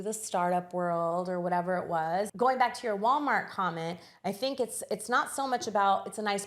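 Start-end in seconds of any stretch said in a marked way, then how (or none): none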